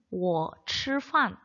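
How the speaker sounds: 我吃饭 very slow, each tone distinct; demonstrated Chinese tones. Shown as a demonstration. Teacher showing students how Chinese tones sound, tone demonstration. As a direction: say 我吃饭 slowly with clear Mandarin tones. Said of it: "이렇게 성조로 인해서 요런 식으로 들립니다".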